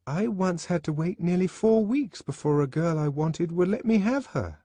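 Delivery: high-pitched youthful confession; high boy's voice; nervous schoolboy. A narrator's reading. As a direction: own up shyly to the class. Boy answers the controller.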